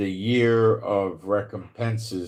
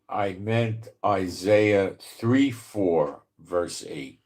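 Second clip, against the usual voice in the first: slow, spelled-out numbers; slow careful diction; slower than usual. Real, not acted. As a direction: say it slowly so the machine gets it. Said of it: "Didn't like my New York accent".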